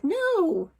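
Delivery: put-on high alarmed child's cry; a frightened yelp of no; cried out in alarm. Scared son cries out against his mother, high alarmed no. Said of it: "He just cried out in alarm".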